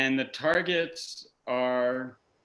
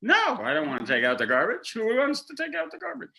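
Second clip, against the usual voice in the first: fast, whiny no, rising much higher; mock refusal; 9 semitones higher, much faster than usual. Husband refusing the garbage, fast and whiny. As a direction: brush it off like a sulky kid. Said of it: "I'm gonna go".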